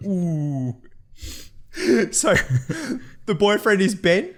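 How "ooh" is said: a drawn-out, tempted ooh; a lightly voiced admiring ooh; mock admiring ooh. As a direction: sound smitten. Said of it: "checking out the other chick going".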